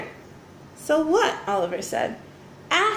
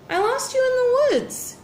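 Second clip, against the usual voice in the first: Oliver's voice; 12 semitones higher than usual. A narrator's reading. Oliver speaks in read-aloud story.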